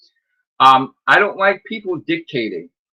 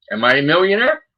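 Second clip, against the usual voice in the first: ordinary talk, nothing marked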